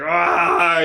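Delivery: a shrill "Ah!" of sudden alarm; mock scream. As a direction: imitate her yelp of fright. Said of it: "she turns around and goes like".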